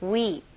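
weep with the ee drawn out long; long vowel. Shown as a demonstration. Teacher shows students the long English vowel in weep. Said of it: "영어 발음은 좀 길게".

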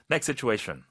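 normal talking voice

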